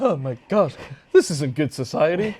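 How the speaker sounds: mock high-society lady; snooty guest. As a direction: play a scandalized rich guest. Mocking snobby wedding guests.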